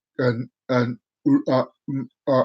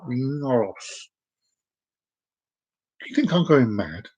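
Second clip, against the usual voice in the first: ordinary talk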